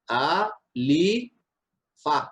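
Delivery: আ, লি, ফা split into three separate syllables; syllable-by-syllable spelling; spelled out syllables. Shown as a demonstration. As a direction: break আলিফ into its three letter sounds. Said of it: "আলিফ বলতে গেলে".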